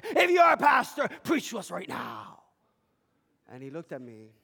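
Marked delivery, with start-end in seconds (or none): 0.0-2.4 put-on high, loud drunken demand; drunk's challenge. Drunk daring the pastor to preach, loud. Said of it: "Well, I'm Pastor".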